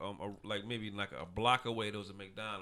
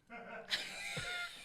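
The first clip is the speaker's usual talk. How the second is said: laughing; 8 dB quieter than usual. Real, not acted. Bursts out laughing mid-story.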